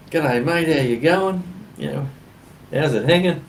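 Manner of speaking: loud, high, played-up Australian greeting; real Australian. Traveller greeting Americans everywhere he went, real Australian. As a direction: pitch it up and go full Aussie. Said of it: "go real Australian".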